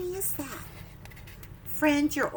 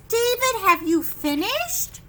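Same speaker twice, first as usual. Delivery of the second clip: teacher's question; 7 semitones higher, 5 dB louder than usual. Acted. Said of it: "It says".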